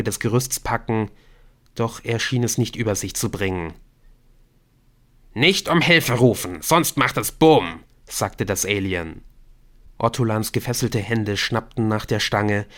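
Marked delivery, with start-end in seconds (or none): 5.3-7.8 louder warning, slowing on the last words; taunting threat. Acted. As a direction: warn the captive against calling out. Alien warns with pistol.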